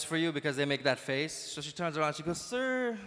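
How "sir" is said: higher, drawn-out Filipino "sir"; Filipino accent. Retold or imitated. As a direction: Filipino breaking bad news gently. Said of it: "when a Filipino has bad news".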